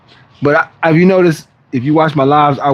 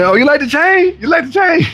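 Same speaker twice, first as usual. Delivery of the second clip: playful mock question, pitched much higher; teasing; 12 semitones higher than usual. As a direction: tease him about the chain in a high voice. Teasing repeat about the chain.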